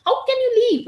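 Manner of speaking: loud, high-pitched angry rebuke; angry scolding. Acted. Rama to his brother who left Sita alone, high angry scolding. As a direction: scold him with voice shooting up. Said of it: "Rama is very angry with Lakshmana".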